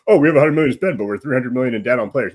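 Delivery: mock naive boast. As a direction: voice a club blithely kidding itself. Mocking a naive club's reasoning.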